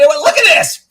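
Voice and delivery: loud, high demand to look; real worked-up outburst; exasperated shout. Real, not acted. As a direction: shout it in fed-up disbelief. Angrily shows pile of emails.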